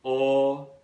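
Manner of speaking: long, held O as in walk and talk; long vowel. Shown as a demonstration. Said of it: "long vowel".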